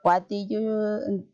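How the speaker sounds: usual speaking voice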